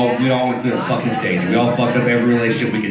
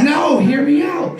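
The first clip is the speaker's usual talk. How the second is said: high, slow, raised insistent mom voice; 12 semitones higher, slower than usual. Retold or imitated. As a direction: call it out, high and pushy. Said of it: "She says".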